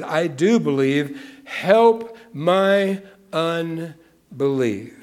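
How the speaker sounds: slow, drawn-out pleading cry; father crying out to Jesus, slow and pleading; father's cry. Acted. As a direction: stretch out the plea for help. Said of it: "the boy's father cried out".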